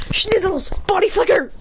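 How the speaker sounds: mock-panicked outburst; acted alarm. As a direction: cry out as you make a quick escape. Recognized character escapes in panic.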